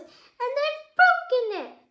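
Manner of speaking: high, loud, slow cub wail; drawn-out high baby bear cry; squeaky Baby Bear. A narrator's reading. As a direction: complain loudly in a little bear squeak. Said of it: "in a squeaky little voice".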